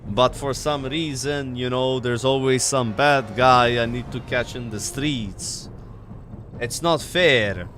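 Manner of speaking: lightly voiced Maltese cop; Maltese accent. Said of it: "Can you R P your character again?".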